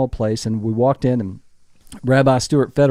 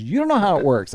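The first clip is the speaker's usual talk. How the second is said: higher voice, falling at the end; firm comeback; 6 semitones higher than usual. Retold or imitated. Wife correcting her mansplaining husband, firm falling comeback. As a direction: turn it back with finality. Wife turns his words back on him.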